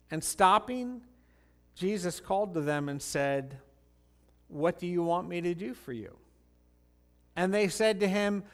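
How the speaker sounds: measured Bible reading voice; scripture reading. Acted. Pastor reads Matthew aloud, measured. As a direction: read the passage to the congregation.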